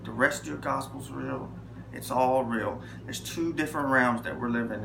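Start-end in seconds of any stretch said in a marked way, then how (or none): 0.0-2.8 softly praying to the Lord; quiet prayer. Retold or imitated. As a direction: pray it low and sincere. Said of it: "I said".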